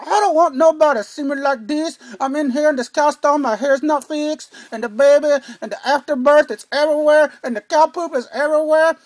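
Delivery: a loud, high, mock-frantic rant; mock freaking out. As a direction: play the frazzled new mother, half joking. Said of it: "she was probably freaking out".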